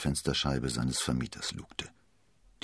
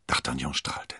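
plain reading voice

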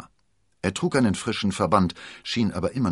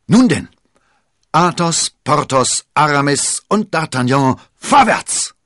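loud, high battle cry; rallying call; 10 semitones higher, 13 dB louder, slower than usual. Acted. Athos rallies his comrades to attack, loud battle call. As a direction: rouse the four to charge. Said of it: "rief Athos".

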